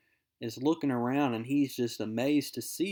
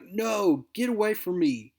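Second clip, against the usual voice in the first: snapping; 7 semitones higher, 4 dB louder than usual. Acted. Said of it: "Harry Osborn snaps".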